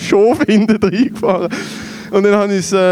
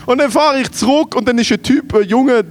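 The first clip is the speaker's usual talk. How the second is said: ordinary talk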